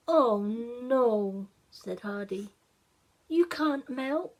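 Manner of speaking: Hardy protests the snowman will melt; dismayed little boy. Acted.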